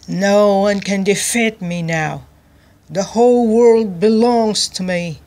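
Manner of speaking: loud, triumphant royal boast. A narrator's reading. King gloating over his captured rival, boasting loudly. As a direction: proclaim it with swaggering force. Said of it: "he boasted".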